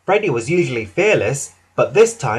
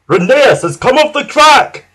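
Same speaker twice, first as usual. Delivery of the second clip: slow, loud, high alarm; distressed little-engine shout; alarmed cry; 10 semitones higher, 8 dB louder than usual. A narrator's reading. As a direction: cry out the bad news in panic. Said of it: "cried Skarloey".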